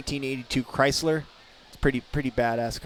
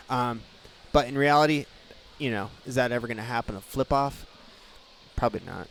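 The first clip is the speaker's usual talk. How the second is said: usual speaking voice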